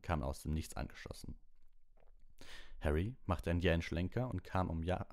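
ordinary delivery

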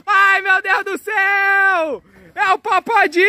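high, drawn-out mock scream; hammed-up terror. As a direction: wail it out in pretend panic. Dinosaur parent appears. (grito de pavor fingido, bem agudo)

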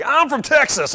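high, loud boastful cowboy bellow; loud bragging Texan. Retold or imitated. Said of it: "he's telling everybody".

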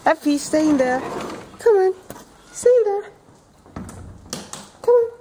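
high loud call to a cat. Real, not acted. Calling the cat.

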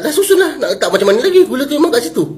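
very high, incredulous retort; retold fed-up answer; exasperated protest. Retelling exasperated answer to boy.

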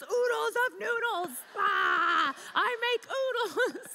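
high, slowed, laughing shout of oodles; excited yell. Retold or imitated. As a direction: proudly belt out the brand name. Teen self shouting proudly about noodles.

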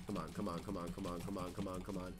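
low, soft, repeated urging under the breath; muttered urging. Real, not acted. Gamer willing the opponent on, muttered urging. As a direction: keep it small and low, willing it along.

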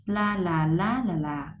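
sing-song syllable pattern peaking on "lá"; "La là, lá là, là" stepping up on the stress, then falling. Shown as a demonstration. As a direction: raise "lá", drop every "là". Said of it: "mình sẽ lên dấu sắc".

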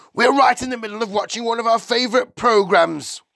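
high, loud protest; put-out, high-pitched householder; indignant father. A narrator's reading. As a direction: complain loudly at being interrupted. Father irritated at being interrupted.

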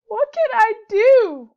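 high, loud, slow sobbing plea; a frightened little rabbit crying out for help; sobbed. A narrator's reading. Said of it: "sobbed Rabbit".

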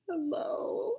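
sad hello. Shown as a demonstration. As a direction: let the hello sag with sadness. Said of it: "I think that seems like sad".

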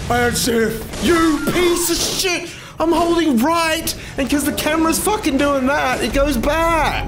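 very high, exasperated yell; real frustrated high-pitched outburst; enraged shouting. Real, not acted. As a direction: rage at the game in a high strained voice. Raging at game camera.

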